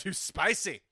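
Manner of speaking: a hint of Scottish; weak Scottish burr; Scottish accent. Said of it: "a terrible Scottish accent".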